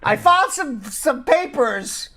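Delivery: high, slow, put-on dopey voice; mocking a clueless caller. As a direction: play the confused asker, drawn out and high. Host mocks a vague caller's question.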